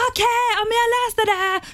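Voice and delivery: high, eager squeaky okay to the producer; startled high yes. Retold or imitated. Caught off guard by the famous producer's call, high startled yes. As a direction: hit a thrilled high pitch, caught off guard.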